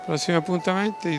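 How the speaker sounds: ordinary talk, nothing marked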